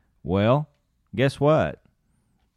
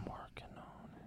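whispering; 26 dB quieter than usual. Real, not acted. Muttering while searching the site.

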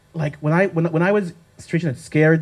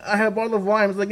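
normal talking voice

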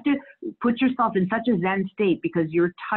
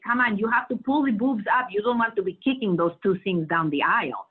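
lightly higher Dominican-accented imitation of her mother; mother's accent; 4 semitones higher than usual. Mother advising brides at fittings, Dominican-accented imitation. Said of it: "my mother used to say".

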